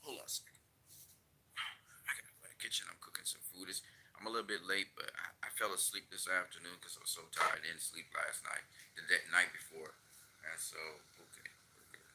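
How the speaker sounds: quiet muttered asides while cooking; hushed aside. Real, not acted. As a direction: drop to a near whisper aside. Steps away to kitchen.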